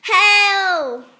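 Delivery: a scared duckling shouting for help; cry for help. Acted. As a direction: yell out to escape the cat. Cat wants ducks for lunch.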